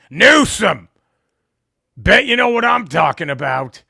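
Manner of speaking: loud mocking yell of a stranger's gripe; mock angry shout. Retelling a stranger who shouts the governor's name in anger. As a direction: bellow the governor's name like a griping local.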